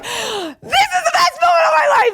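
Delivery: high, slow ecstatic shout; triumphant joy. Retold or imitated. Teen seeing sister arrive, elated shout. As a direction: crow with delight. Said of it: "and I was like".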